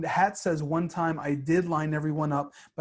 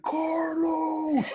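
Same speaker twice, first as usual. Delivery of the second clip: high, drawn-out mock holler; held-back imitation of a prank yell; imitated yell; 12 semitones higher than usual. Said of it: "we would just yell out of nowhere".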